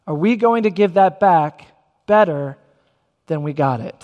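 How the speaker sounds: phrase by phrase, pausing before and after "better". Real, not acted. Preacher poses challenge, pausing for weight.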